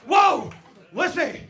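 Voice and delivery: high, loud whoas; carried-away yelling; excited whooping. Retold or imitated. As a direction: shout to the wife over the buzz. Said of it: "I was like".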